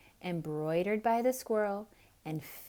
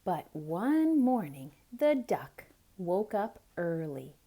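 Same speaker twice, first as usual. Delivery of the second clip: ordinary delivery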